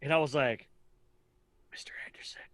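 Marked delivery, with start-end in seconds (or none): 1.7-2.4 a hushed, secretive whisper of the name. Retold or imitated. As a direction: whisper it across the waiting area, trying not to be overheard. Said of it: "like, whispering".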